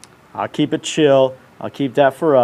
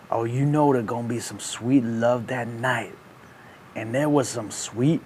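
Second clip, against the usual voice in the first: hushed, lower, suggestive aside; sly bedroom murmur; 5 semitones lower, 11 dB quieter than usual. Acted. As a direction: drop down low and soft, savoring it. Teasing about intimate night.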